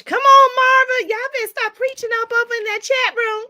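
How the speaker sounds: high, loud playful hollering at chat; excited call-out. Real, not acted. Host hollering at her chat room, high and playful. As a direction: hype the chat up.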